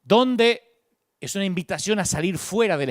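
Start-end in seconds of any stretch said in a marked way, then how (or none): 0.0-0.6 Dónde said loud and high; preacher underlines God's Dónde, loud; emphatic Dónde. Shown as a demonstration. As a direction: punch the word Dónde. Stressing God's question.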